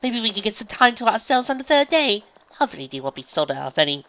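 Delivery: high, loud, eager lady; excited refined unicorn. Acted. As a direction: gush with enthusiasm, high and loud.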